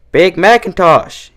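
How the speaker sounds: calling across the farm, drawn out; called out. A narrator's reading. Applejack calls her brother across the yard, drawn out. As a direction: call him over from a distance. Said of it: "she called".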